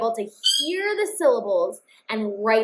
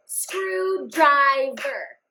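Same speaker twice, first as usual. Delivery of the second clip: Screwdriver said very slowly, one clap per syllable; clapped out syllables; 2 semitones higher, much slower than usual. Shown as a demonstration. Teacher claps out screwdriver for the class to count. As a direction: clap it out slow and even. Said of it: "Let's clap it out".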